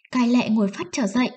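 plain reading voice

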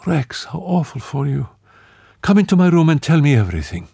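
high, quick, concerned; sympathetic woman. Acted. Marie-Lou sympathizes with Rex, high and hurried. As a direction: rush to comfort him.

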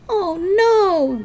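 high cry of alarm; shouting. Acted. Melody spots the moving vans, shouting in alarm. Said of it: "Melody shouted".

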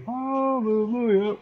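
high, slow sung-out praise; high churchy hallelujah. Pretend. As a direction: stretch it up like a choir. Mock churchy praise after sneezing.

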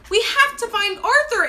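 high, loud, urgent storybook shout. A narrator's reading. Angelina urging Henry to search, shout. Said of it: "Angelina shouted".

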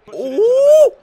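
very high, loud "ooh!"; big high-pitched yelp; excited whoop. Real, not acted. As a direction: whoop at the play. Reacting to play on screen.

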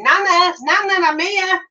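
put-on high, drawn-out child's call of Nana. Granddaughter arriving, calling to Nana, high and drawn out. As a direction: call up the street like an excited toddler. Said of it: "I could hear her going".

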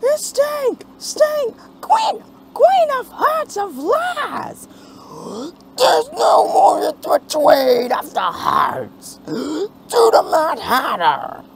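high, loud angry chant; protest shouting. Acted. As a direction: yell it like an angry mob.

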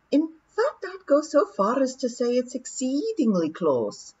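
William's pixie voice. Acted. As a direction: understate the danger calmly. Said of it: "said William".

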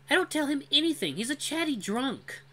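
high, scornful female voice; scornful young woman. Acted. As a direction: dismiss him with scorn. Cora dismisses her father.

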